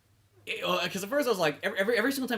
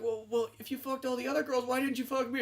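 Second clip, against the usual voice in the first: higher, softer mock-wounded voice; hurt crew member; 6 semitones higher, 7 dB quieter than usual. Passed-over crewmate questioning the hero, mock hurt. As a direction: ask it small and pleading, pitched up.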